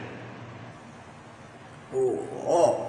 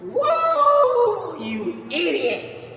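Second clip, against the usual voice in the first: gloating whoop; 12 semitones higher, 3 dB louder, much slower than usual. Real, not acted. Taunting after another win.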